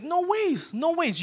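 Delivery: high, quick scoffing no; incredulous dismissal. Retold or imitated. The congregation scoffing at the eyewitness, incredulous. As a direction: brush it off as absurd. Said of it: "all of us would be like".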